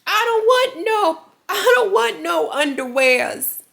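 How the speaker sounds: put-on high, loud, slow whiny toddler; a little boy refusing his underwear; stretched-out toddler wail. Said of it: "that video of when he didn't want a potty train".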